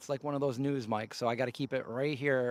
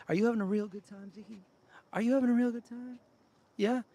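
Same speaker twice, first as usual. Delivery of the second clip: talking to the dog; 9 semitones higher than usual. Acted. Sweet-talking the dog Ziggy.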